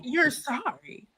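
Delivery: slow, disbelieving question, softly acted; retold stunned question, drawn out and a little soft; incredulous echo. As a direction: echo the apology back slowly, in disbelief. Retold daughter challenging dad's apology.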